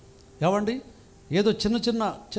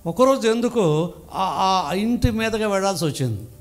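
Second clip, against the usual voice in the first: normal talking voice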